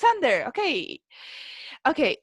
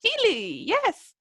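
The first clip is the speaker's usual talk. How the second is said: normal talking voice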